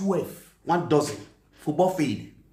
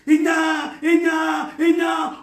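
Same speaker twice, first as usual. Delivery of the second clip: shouted cry; 6 semitones higher, slower than usual. Real, not acted. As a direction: call it out again and again.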